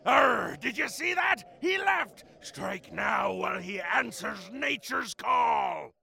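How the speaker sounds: high, rousing pirate cry; excited pirate shout. Acted. Pirate captain seeing the guard leave, excited shout. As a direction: shout the order to your crew.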